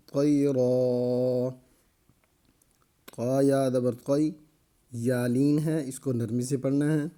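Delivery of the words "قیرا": قیرا with the tanween turned into a long ا, slowly drawn; slow, stretched قیرا ending on an open ا; pausal stop reading. Shown as a demonstration. Teacher shows the class the stopping form, slow and long. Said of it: "آگے وقف".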